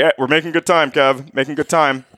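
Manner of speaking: high, cheerful, oblivious dad; a hint of a chipper dad brushing it off; lightly voiced dad pleased with the drive; upbeat dad. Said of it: "Your dad, like".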